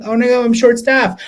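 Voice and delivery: high-pitched retold "oh no" worry; acting out a small panic; mock dismay. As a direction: lift the pitch into comic alarm. Acts out dismay at staff shortage.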